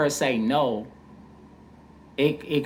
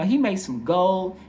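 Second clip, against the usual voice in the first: lightly voiced girl, high and drawn out; higher-pitched mimicry of a proud daughter; imitated teen girl; 6 semitones higher, 3 dB louder, slower than usual. Quoting the girl about her dad.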